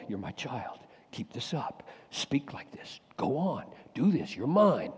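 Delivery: soft, hushed fatherly urging; gentle encouragement. Pretend. God encouraging the preaching pastor, softly tender. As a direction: quietly coax him onward.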